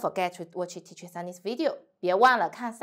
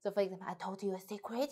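ordinary talk

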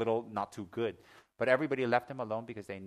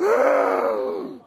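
loud, high shriek of fright; huge mock-terrified gasp; jump-scare intake, high and loud; startled gasp; 18 dB louder than usual. Acts out his scare.

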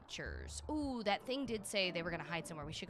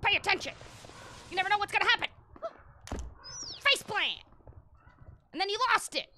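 much higher, loud playful telling-off; mock scolding shout; 12 semitones higher, 9 dB louder, faster than usual. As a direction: yell at him like a mock drill sergeant. Mock scolding a game character.

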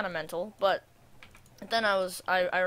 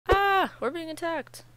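mock alarm; 11 semitones higher, 7 dB louder, slower than usual. Real, not acted. Mobs attack during building.

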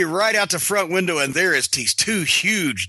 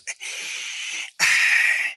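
long, breathy, put-on sigh of resignation; mock heavy sigh. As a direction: sigh like a man hit by his fate. Mock contrition over court papers.

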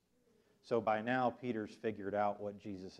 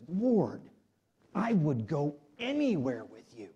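impassioned, drawn-out vow; fervent pledge; 4 semitones higher, 5 dB louder, slower than usual. Retold or imitated. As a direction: pledge devotion like an eager disciple. Acting Peter's pledge of devotion to Jesus.

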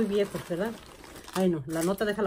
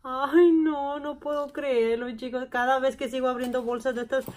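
high, loud burst of delight; real excitement; 4 semitones higher, 4 dB louder than usual. Real, not acted. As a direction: squeal with joy at the haul. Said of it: "me emociono y no quiero que se acaben".

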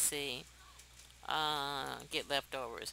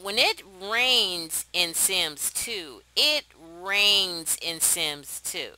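drawn-out, loud, savouring every word; loud slow marvel; slow emphatic awe; 5 dB louder, much slower than usual. Real, not acted. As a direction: stretch it out like it's amazing. Emphatic delight at Sims rain.

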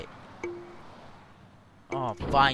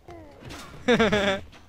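high burst of laughter; 8 semitones higher, 4 dB louder than usual. Real, not acted. Player amused by game chaos, high laugh. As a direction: laugh out loud in quick pulses.

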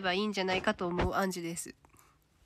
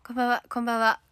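ordinary talk

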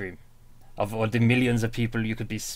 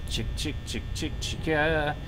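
calling chickens; 3 semitones higher, 4 dB quieter than usual. Pretend. Calling to Chica.

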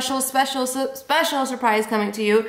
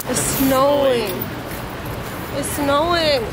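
drawn-out whine about the snow; whiny fake-tearful complaint; mock crying; 3 semitones higher than usual. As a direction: moan it as if about to cry. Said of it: "Stop crying".

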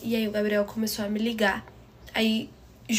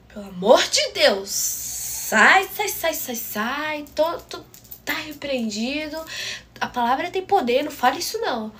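loud, high-pitched comic panic; frantic superstitious rebuke, played for laughs; mock horror; 4 semitones higher, 5 dB louder than usual. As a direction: shoo it away in hammed-up alarm. Mock-horrified rebuke in retold call.

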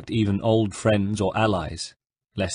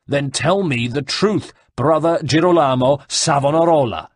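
shouted; 5 semitones higher, 5 dB louder than usual. Acted. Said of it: "shouted the guard".

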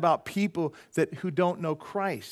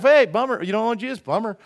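loud, breezy "bummer", higher and louder; careless shrug; 6 semitones higher, 8 dB louder than usual. Pretend. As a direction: toss it off like it doesn't matter. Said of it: "I just kinda go through life".

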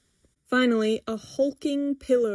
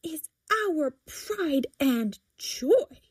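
slow, higher indignant defence; fierce hiss; 6 semitones higher, much slower than usual. A narrator's reading. Daughter defending the cat to mother, hissing mildly. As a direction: space out each word with mild ferocity. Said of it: "Priscilla hisses fiercely".

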